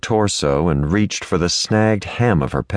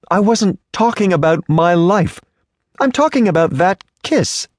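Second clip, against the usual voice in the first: high, haughty rebuke; prim indignant lady; 10 semitones higher than usual. Acted. Offended lady scolds the stranger who grabbed her, high and haughty.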